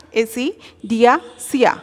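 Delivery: অ্যাসিডিয়াসিয়া slowly, syllable by syllable; careful pronunciation. Shown as a demonstration. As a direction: spell out অ্যাসিডিয়াসিয়া slowly for students. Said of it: "দেখো উচ্চারণগুলো দেখো".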